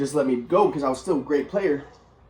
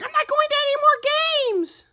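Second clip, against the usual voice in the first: mocking mom. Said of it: "My mom's like".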